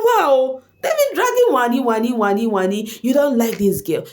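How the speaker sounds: normal talking voice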